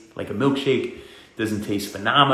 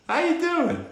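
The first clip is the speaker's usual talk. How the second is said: squeaky greeting; 5 dB quieter than usual. Acted. Greeting a viewer in a put-on voice.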